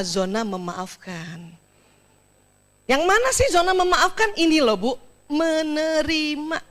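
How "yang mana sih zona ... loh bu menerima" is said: usual speaking voice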